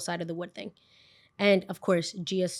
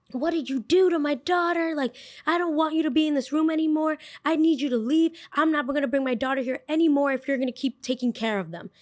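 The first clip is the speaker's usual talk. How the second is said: high-pitched imitation of a furious mother; mock angry yelling; 7 semitones higher than usual. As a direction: act out an outraged parent telling off a teacher. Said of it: "she hears her yelling at me".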